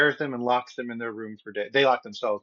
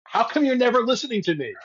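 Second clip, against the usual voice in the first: high, loud mock complaint; complaining wife; 11 semitones higher, 5 dB louder than usual. Wife in a joke opening a conversation, mock complaining. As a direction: play an exasperated wife for laughs.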